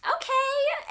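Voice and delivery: a princess answering the photographer in a squeaky voice; squeaky high okay. Retold or imitated. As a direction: pitch the okay way up in a playful impression. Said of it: "And she's like".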